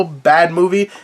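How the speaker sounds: normal talking voice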